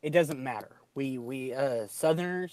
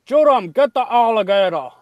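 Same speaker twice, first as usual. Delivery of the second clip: higher, louder Cajun call; a higher, loud Cajun accent calling out; Cajun impression; 7 semitones higher, 12 dB louder than usual. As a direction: holler like Troy from Swamp People. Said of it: "the guy who would sit there and say".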